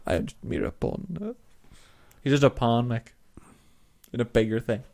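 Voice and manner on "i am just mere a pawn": put-on German accent. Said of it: "I can't do a German accent".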